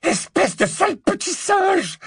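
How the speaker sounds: very high furious witch screech; high, enraged shriek; bawling insult. A narrator's reading. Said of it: "brailla Bellatrix".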